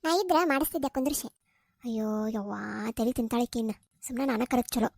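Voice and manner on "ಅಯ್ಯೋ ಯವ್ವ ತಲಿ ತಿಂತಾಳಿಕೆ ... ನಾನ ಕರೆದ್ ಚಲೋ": low, soft exasperated mutter; grumbling aside. Acted. Granny grumbling to herself about the pestering girl. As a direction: grumble under the breath, fed up.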